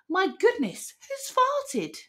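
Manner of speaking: high-pitched scandalised wonder; wondering gasp. A narrator's reading. Animals wondering who made the smell, high-pitched wonder. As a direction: ask it high, in shocked wonder. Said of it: "whisper and wonder".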